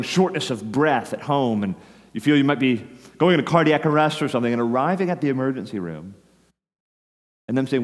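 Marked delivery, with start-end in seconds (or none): none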